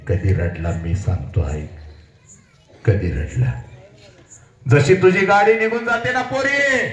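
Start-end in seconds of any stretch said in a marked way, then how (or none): 4.7-6.9 high, rising cry; fervent high declamation; impassioned oratory. Acted. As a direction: soar toward the final word.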